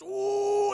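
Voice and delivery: high, loud drawn-out "ooh"; mock pained "ooh". Streamer acting out a belly stabbing, mock pained howl.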